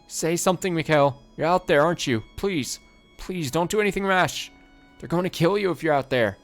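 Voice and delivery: high, loud begging; desperate pleading. Acted. Trapped woman begging Mikhail not to act rashly. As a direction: beg him not to do it. Begging him through the door.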